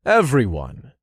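ringing, commanding call. A narrator's reading. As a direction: call the whole room to attention. Dark lord calling to the room.

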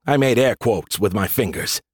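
ordinary delivery

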